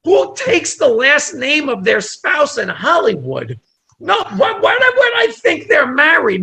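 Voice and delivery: high, rising outrage of disbelief; who would ever guess that; incredulous. Retold or imitated. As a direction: squeal it like you can't believe it. Indignant disbelief, voice shooting up.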